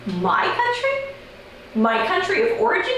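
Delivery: incredulous. Acted. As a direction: echo it in outraged disbelief. Told she may be deported.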